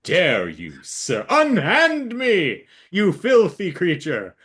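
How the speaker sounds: mock-outraged bird, building to a high cry; indignant. Captured bird to the man holding it, hammed-up indignation. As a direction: act grandly affronted, stretching the words. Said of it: "a very indignant look".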